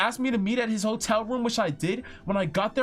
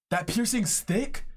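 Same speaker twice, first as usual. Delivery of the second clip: ordinary delivery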